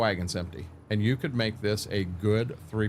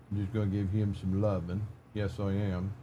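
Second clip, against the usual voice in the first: talking to a dog; 4 dB quieter than usual. Real, not acted. Soft talk to his dog.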